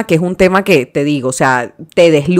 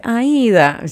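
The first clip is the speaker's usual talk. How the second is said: "Aida" with a soft paisa d, a little higher; paisa accent; 5 semitones higher, 4 dB quieter than usual. Retold or imitated. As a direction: say "Aida" like a woman from Medellín. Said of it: "cuando una paisa me dice".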